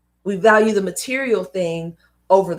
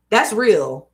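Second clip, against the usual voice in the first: normal talking voice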